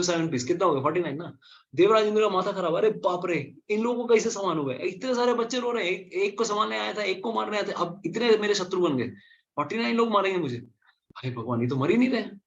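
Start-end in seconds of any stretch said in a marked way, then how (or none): 1.7-12.4 panicked Indra. Acted. Indra panics over multiplying children.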